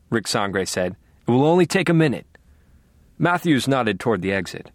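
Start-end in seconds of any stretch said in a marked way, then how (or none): 1.3-2.3 hurried pleading. A narrator's reading. Reporter pleading for footage.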